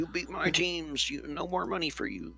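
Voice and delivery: mock team owner. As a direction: play a boss pulling the purse strings. Imagining team owner cutting funding.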